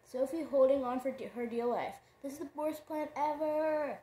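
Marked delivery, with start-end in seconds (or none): 2.2-4.0 higher, whining complaint; whiny wail. A narrator's reading. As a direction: wail it while clinging on. Sophie wailing mid-flight.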